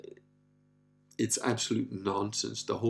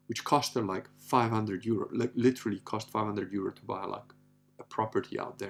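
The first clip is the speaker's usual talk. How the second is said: ordinary talk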